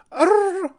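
high, loud rolled-R trill; trilled "arr" outburst. Retold or imitated. Podcaster joking on the film title, high trill.

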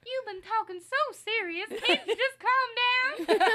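very high squeaky voice; helium voice. Pretend. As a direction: chipmunk pitch, dragged out slowly. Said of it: "She's, like, sucking helium".

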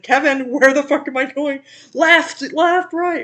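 loud, high mock-frantic shouting; hammed-up shouts for directions; retold frantic yelling. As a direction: re-enact yelling after a friend while lost. Retells yelling to Kevin on scooter.

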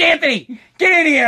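loud, high call from the kitchen; a mother summoning her kid, loud; mom yelling. Retold or imitated. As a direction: yell his name across the house.

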